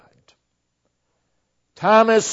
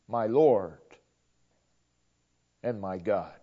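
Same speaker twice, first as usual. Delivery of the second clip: hushed reverent quote; 11 semitones lower, 11 dB quieter than usual. Acted. Quoting Thomas's confession reverently.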